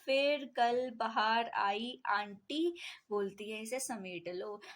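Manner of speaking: word by word, drawn out for memorising; measured memory-aid delivery, falling away at the end; slow mnemonic dictation. Shown as a demonstration. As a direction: dictate it slowly, spacing out each word. Dictating a memory phrase slowly.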